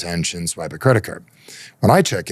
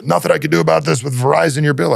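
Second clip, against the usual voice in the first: raised, louder mock support agent; mock customer-service refusal, high and loud; imitated customer support person; 8 semitones higher, 5 dB louder than usual. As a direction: brush off the caller in a higher agent voice. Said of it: "on the phone with a customer support person".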